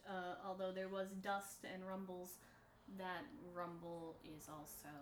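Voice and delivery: ordinary delivery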